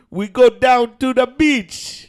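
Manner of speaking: loud, slow, botched Irish accent; Irish attempt that comes out Jamaican, pitched high. Shown as a demonstration. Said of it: "Does this sound Irish?".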